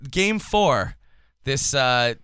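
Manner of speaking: ordinary talk, nothing marked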